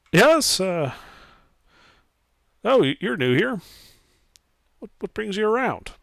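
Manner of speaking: high-pitched woman, friendly; lightly voiced middle-aged woman. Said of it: "middle-aged woman".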